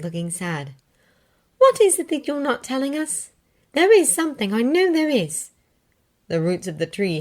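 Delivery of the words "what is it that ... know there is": Hermione voice. Acted.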